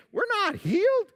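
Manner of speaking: very high-pitched disbelief; high, baffled objection; incredulous protest. Acted. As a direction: squeak it out in acted astonishment. Lepers see they are still sick.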